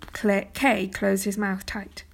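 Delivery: plain reading voice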